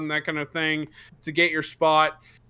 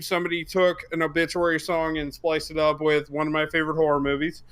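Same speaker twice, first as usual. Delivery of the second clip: ordinary talk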